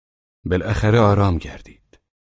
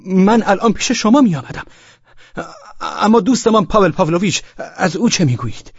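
put-on higher, louder, flustered young voice; breathless young man; 11 semitones higher, 5 dB louder than usual. Young man hurrying up to Velchaninov, breathless and flustered. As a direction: rush in out of breath and upset. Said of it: "به نفس نفس افتاده بود و پریشان بود".

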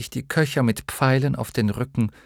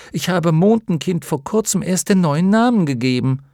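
high, raised, offended retort; a little hurt; 9 semitones higher, 6 dB louder than usual. A narrator's reading. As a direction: defend yourself, piqued and louder. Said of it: "ein wenig gekränkt". (gekränkt, mit erhobener Stimme)

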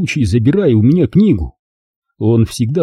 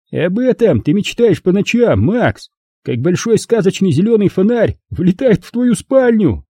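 high, taunting, theatrical jeer; mocking bully Rob; 8 semitones higher than usual. A narrator's reading. Rob mocking Max's dreams, a high theatrical taunt. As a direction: jeer at him in front of everyone.

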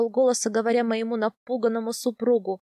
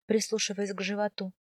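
plain reading voice

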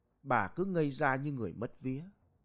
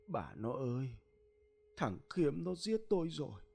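ghostly husband, a touch of weeping, lightly voiced; tearful ghost husband; 4 semitones higher than usual. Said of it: "rồi khóc rưng rức".

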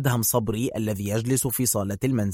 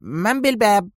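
much higher put-on voice; disguised voice; 10 semitones higher than usual. A narrator's reading. Adham hides his real voice from the visitor, disguised. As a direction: fake a different voice at the door. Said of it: "بصوت يخالف تماما صوته الحقيقي".

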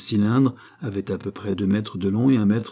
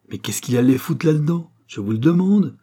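raised, high exclamation of exasperation; indignant outcry; 6 semitones higher, 5 dB louder than usual. A narrator's reading. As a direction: cry out the question, louder and higher. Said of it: "s'écria Magali".

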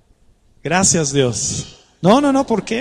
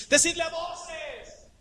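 high, stretched-out call; 9 semitones higher, 11 dB quieter, slower than usual. Shown as a demonstration. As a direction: call it out so all can hear. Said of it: "Es así".

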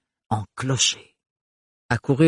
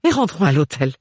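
loud, high fussy mother; bossy matron pushing her daughter; overbearing mother; 10 semitones higher, 6 dB louder than usual. Acted.

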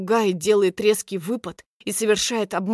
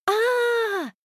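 high held delighted squeal. A narrator's reading. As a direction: squeal with joy. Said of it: "визжит подруга".

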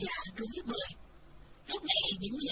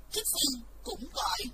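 plain reading voice

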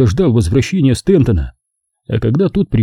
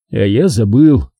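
plain reading voice

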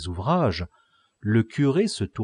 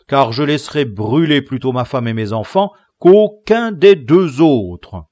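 a touch louder, stubborn host; lightly voiced pleading innkeeper; 6 semitones higher, 12 dB louder than usual. A narrator's reading. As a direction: bargain for his books, mildly heated.

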